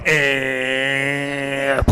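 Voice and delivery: long, low droning "ehhh"; steady sustained whir made by mouth; car window noise. Retold or imitated. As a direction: imitate a window motor winding up. Imitates car window rolling up.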